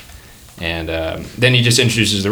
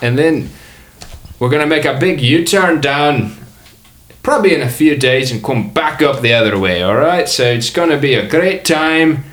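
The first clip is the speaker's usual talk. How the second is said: put-on muddled Australian-Scottish accent, pitched up; mixed-up comic accent; 5 semitones higher, 4 dB louder than usual. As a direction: cruise host's wandering Australian-Scottish patter. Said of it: "He's Australian and Scottish".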